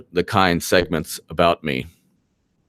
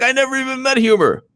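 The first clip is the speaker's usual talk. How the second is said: much higher, quick mock denial; squeaky hammed-up innocent denial; mocking Mark Meadows; faster than usual. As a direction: push the mock voice even higher. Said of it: "He's like".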